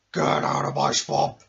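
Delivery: big booming crocodile bellow, slowed down; selfish crocodile roar. Acted. Crocodile warning the children off his swamp, booming. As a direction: roar it like a greedy croc guarding his swamp. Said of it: "when you hear this sound".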